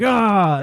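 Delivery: mock scream. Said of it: "one guy that was like".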